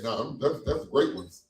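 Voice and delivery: ordinary delivery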